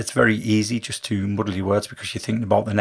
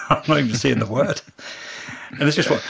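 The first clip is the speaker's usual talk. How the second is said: ordinary talk, nothing marked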